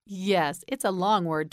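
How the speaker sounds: ordinary delivery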